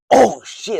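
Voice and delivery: real shock blurted out; startled outburst. Real, not acted. Vlogger catching his own planet mistake, shouted surprise. Realizes planet order was wrong.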